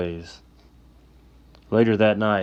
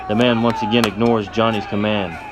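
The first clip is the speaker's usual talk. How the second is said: ordinary delivery, nothing marked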